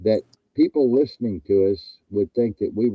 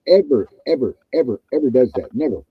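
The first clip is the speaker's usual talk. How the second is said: ordinary delivery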